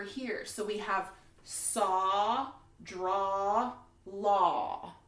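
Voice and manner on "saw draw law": "saw, draw, law" with a long drawn aw vowel; stretched aw. Shown as a demonstration. Teacher modeling the aw sound for second graders, drawn-out. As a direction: exaggerate the aw in each word. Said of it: "Do you hear how it's making the aw sound".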